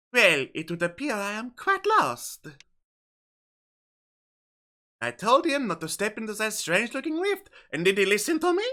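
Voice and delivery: hammed-up French accent. French woman lost in a rift, fretting in a French accent. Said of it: "for my accent".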